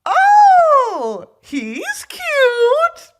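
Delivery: giddy fangirl gushing about Ghost; swooning. Real, not acted. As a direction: gush over him like a fangirl. Swooning over a game character.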